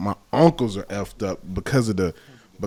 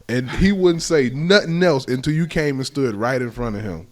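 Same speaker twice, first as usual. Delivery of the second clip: normal talking voice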